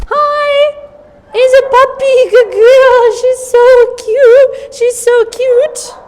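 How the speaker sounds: very high, loud, slow cooing praise; high pitch praise. Real, not acted. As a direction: coo at the puppy way up high. Cooing at puppy.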